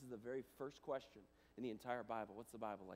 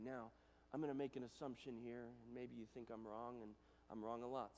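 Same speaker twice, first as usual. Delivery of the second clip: usual speaking voice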